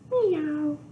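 high meow; playful high kitty meow; cat meow. Pretend. Teacher makes cat sound.

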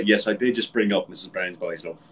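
usual speaking voice

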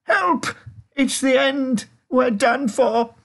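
very high, loud wail; terrified, drawn-out cries; panicked shout. A narrator's reading. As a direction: scream for help as if doomed. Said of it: "Grandpa Joe shouted".

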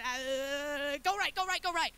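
hammed-up flustered navigator; stall, then burst out with urgent directions; mock panic. Acting out panicked GPS directions.

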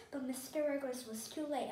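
ordinary delivery

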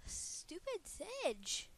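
slow, high mocking drawl; annoyed drawl. Real, not acted. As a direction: drag out the insult. Annoyed at an enemy.

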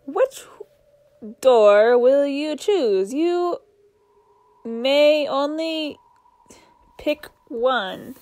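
ominous talking book. Acted. Talking book teasing the girl about which door, slow ominous drawl. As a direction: draw every word out like a sly trickster.

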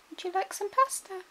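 high sing-song teasing offer. Real, not acted. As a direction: dangle the pasta in a high voice. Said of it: "I'm not going to tease you anymore".

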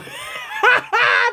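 shrieking laugh, high; sudden high shriek of laughter; high burst of laughter. Real, not acted.

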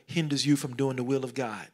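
normal talking voice

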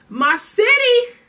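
high, loud and slow burst of joy; excited shout. Real, not acted. Vlogger cheering for his home city, excited shout. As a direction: shout it out, thrilled about home. Excited about hometown San Diego.

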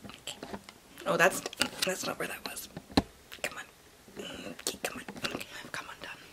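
hushed whisper; soft aside whisper. Real, not acted. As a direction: keep it to a whisper. Whispering at the camera.

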